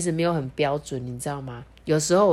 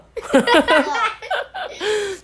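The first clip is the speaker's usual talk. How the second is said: bright high burst of laughter. Real, not acted. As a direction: laugh at yourself. Laughs at own accent.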